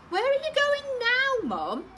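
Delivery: put-on very high, loud little girl; high child voice. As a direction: call after mum like a child. Girl asks mum.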